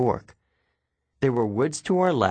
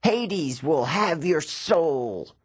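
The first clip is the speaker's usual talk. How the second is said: very slow, higher monster threat; menacing fury; 6 semitones higher, much slower than usual. A narrator's reading. Fallen Fury vowing revenge on Percy, slow menace. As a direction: hiss out a vow of doom. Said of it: "she promised".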